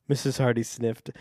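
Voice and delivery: ordinary talk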